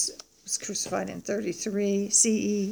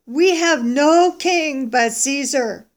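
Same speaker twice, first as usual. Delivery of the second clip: loud, slow, raised-pitch retort; defiant declaration; 4 semitones higher, 7 dB louder, much slower than usual. Acted. Chief priests answering Pilate, defiant declaration. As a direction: declare it firmly, stretching each word.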